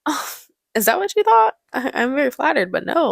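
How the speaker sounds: imitating a woman feigning surprise; mock-flattered Mrs. Robinson. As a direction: act coyly taken aback. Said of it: "And she's like".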